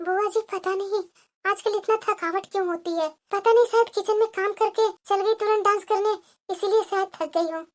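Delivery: soft, winded, worn-out voice; daughter-in-law, worn out after dancing, answers her aunt breathlessly; breathless and tired. Acted. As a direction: speak like you're short of breath after dancing. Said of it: "इसकी सांस कैसे फूल रही है".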